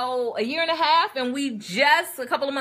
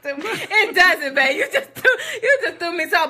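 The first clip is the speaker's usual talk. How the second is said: laughing; 5 semitones higher than usual. Real, not acted. Wife laughs at husband's remark.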